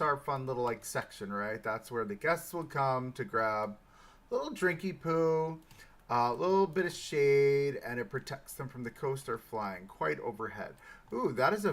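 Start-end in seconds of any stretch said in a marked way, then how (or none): 4.3-7.8 playful cutesy. Acted.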